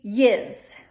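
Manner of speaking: Delco "Yiz." said with a short i and a z at the end; "Yiz." rhymes with fizz, the Delco way. Shown as a demonstration. Teacher says "Yiz." for the class in a Delco way. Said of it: "In Delco, it's gonna be".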